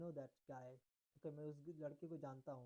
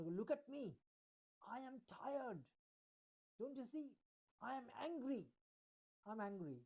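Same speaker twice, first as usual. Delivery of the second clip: higher, insistent mock-angry complaint; angry demo; 5 semitones higher, much slower than usual. Shown as a demonstration. Teacher acting angry to show emotion in English. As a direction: act annoyed, pitch raised. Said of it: "जैसे मैं थोड़ा सा अगर गुस्से में हूँ".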